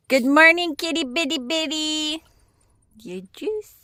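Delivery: high, loud cooing to the cat; sing-song greeting to a kitty. Real, not acted.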